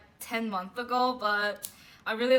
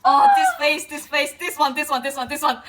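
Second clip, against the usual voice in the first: high, loud squeal on the first words, then loud insistent repeats; excited squeal; 5 semitones higher, 13 dB louder than usual. Real, not acted. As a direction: squeal it, pointing at the favorite. Squealing at favorite photo.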